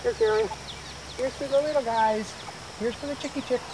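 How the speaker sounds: high, soft coaxing chicken call. Real, not acted. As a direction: coo to the little chicks.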